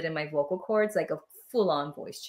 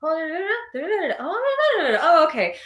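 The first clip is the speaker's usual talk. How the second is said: rising singsong da-da babble, climbing much higher and ending louder; mock inner chatter; 10 semitones higher, 3 dB louder, much faster than usual. Medium describing talking to herself mid-channel, high rising babble. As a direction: babble nonsense syllables climbing up and up, then snap to "oh, okay". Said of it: "I'll be like".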